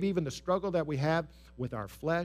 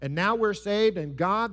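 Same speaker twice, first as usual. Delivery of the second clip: ordinary talk, nothing marked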